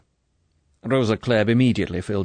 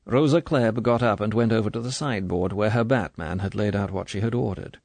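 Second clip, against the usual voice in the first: ordinary delivery, nothing marked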